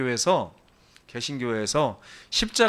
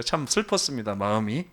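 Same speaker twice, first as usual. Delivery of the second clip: ordinary talk, nothing marked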